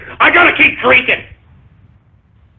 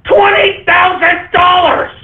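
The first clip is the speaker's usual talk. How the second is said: higher yell, each word dragged out slowly; slower, disbelieving shout of the price; slow outraged shout; 7 semitones higher than usual. Real, not acted. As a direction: stretch the price out, still shouting.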